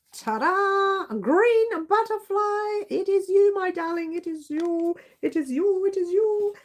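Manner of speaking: excited reveal. Real, not acted. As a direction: celebrate the winner with delight.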